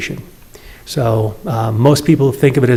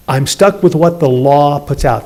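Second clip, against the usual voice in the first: ordinary talk, nothing marked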